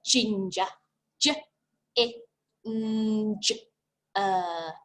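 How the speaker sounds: Ginger, then J, I, N, G, E, R each sound apart. Shown as a demonstration. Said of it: "let's have a listen to the sounds".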